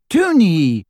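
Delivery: high, bright call of surprise; girl surprised to find her sister hiding; surprise and welcome. A narrator's reading. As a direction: greet her sister, startled but glad. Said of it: "surprise and welcome in her voice".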